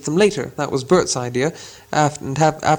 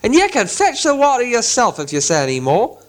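a hint of a higher troll grumble; grumpy troll threat; 7 semitones higher than usual. Trolls threatening the voice, lightly voiced troll. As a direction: mutter the threat. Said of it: "they said".

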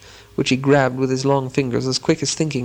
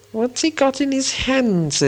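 very high, slow, suspicious creature voice; Gollum's voice; 11 semitones higher, slower than usual. A narrator's reading. Gollum eyeing Bilbo's sword, high and wary. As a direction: eye the sword warily, pitched way up. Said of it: "said Gollum".

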